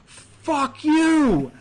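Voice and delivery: high, loud snapped curse; mock angry curse. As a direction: spit it out at him. Voicing Martinez cursing coach.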